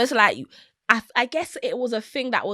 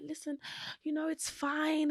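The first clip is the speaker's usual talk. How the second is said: put-on soothing boyfriend; 7 semitones higher, 9 dB quieter than usual. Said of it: "And he was like".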